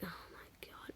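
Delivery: whispered under the breath, barely voiced; exasperated whisper. Student reacting to teacher's threat, exasperated whisper. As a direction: whisper it to yourself in disbelief.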